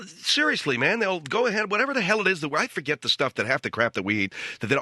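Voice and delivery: normal talking voice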